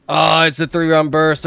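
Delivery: normal talking voice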